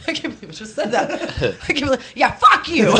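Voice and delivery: laughing through the words; laughing in disbelief. Real, not acted. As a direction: crack up at what you just said. Laughing at her own threat.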